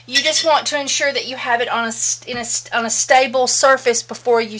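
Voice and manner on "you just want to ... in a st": ordinary talk, nothing marked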